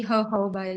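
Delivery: jojoba as "ho-HO-bah"; allowed American pronunciation. Shown as a demonstration. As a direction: offer jojoba with H sounds, "hoh-HOH-bah". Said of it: "You can say jojoba".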